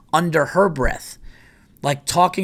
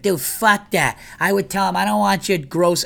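higher, put-on buddy voice egging him on; mimicking Bobby; 6 semitones higher than usual. Friend Bobby on the phone urging him, higher mock voice. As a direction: play the indignant friend. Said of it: "Bobby's like".

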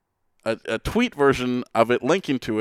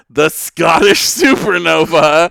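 announcer shout; 7 semitones higher, 10 dB louder, slower than usual. Pretend. Ring announcer intro of wrestler.

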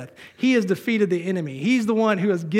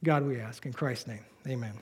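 soft, low-key prayer voice; hushed closing prayer to God; 4 semitones lower, 8 dB quieter than usual. Real, not acted. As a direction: pray it quietly, lower at the close. Closes an opening prayer.

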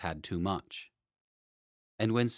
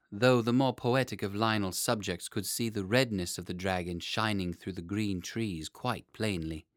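usual speaking voice

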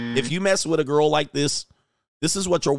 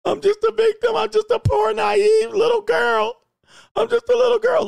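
very high, loud fake-crying girl voice; mock victim whine; 5 dB louder than usual. Streamer mocking her victim act, screeching mock sobs. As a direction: wail like a self-pitying little girl.

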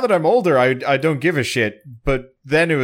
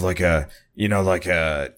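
deep gravelly voice; 8 semitones lower than usual. Shown as a demonstration. Demoing the voice he used.

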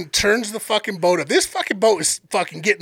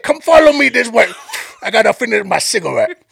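loud Cajun guide leading the way; Sambo's Cajun accent; 3 semitones higher, 6 dB louder than usual. Retold or imitated. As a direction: lead the group off in a Cajun accent. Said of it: "I'm gonna play this dude's accent".

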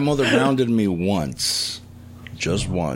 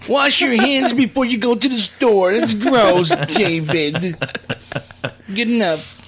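high loud shrieking mother; yelling mom; 10 semitones higher, 3 dB louder than usual. Pretend. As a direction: bark orders like an Italian mom.

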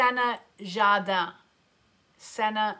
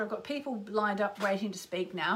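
ordinary talk

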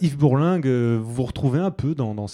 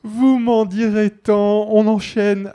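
high slow drawl; 7 semitones higher, slower than usual. Acted. Host reacts to absurd guest.

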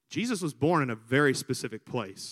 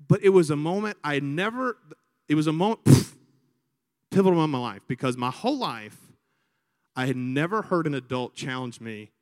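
ordinary talk

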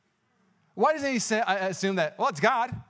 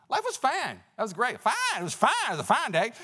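put-on a sudden playful Southern accent, pitched up; Southern drawl; 7 semitones higher than usual. Pastor jokes about life before shutdown in Southern accent. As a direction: slip into a joking Southern drawl on fine. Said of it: "I don't know why I went Southern on you".

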